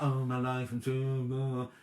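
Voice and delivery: slow, drawn-out mock moan. Mocking her own complaining to viewers, slow moan. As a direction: sulk it out as a parody of herself. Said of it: "I moan about".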